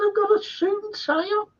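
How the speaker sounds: a high, incredulous squeal of alarm; mock panicked squeak. Actor retelling the director's horse request, high squeaky protest.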